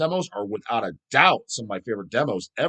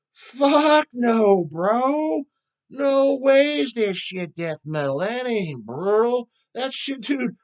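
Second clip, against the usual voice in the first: much higher, put-on bro voice, scoffing; mocking dumb metal bro; 10 semitones higher, much slower than usual. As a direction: play a smug brutal-metal bro waving it off. Mocks a metal bro's answer.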